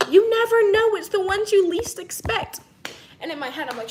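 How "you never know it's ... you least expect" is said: loud, higher, emphatic; all caps. Retold or imitated. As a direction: shout the text like all caps. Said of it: "she says in all caps".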